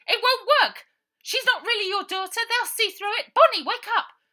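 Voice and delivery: high, very loud defiant scream; desperate scream. A narrator's reading. Ida defying Uncle Arthur, high desperate scream. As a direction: scream at him, then try to wake her.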